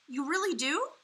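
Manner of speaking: high, loud delighted question; suddenly light up with joy; brightening. A narrator's reading. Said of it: "suddenly brightening".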